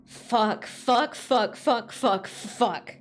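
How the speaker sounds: rising desperate curses; frantic swearing. Acted. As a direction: spit out each curse. Character breaks down swearing.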